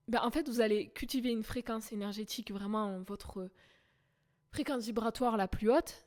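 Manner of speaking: usual speaking voice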